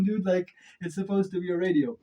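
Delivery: normal talking voice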